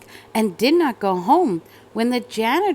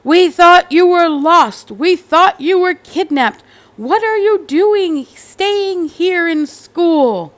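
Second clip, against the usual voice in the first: loud, higher, scolding shout at his daughter; father yelling; 8 semitones higher, 7 dB louder than usual. Acted. Father at the window yelling at his runaway daughter. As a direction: yell with relief turned to anger. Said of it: "He yelled".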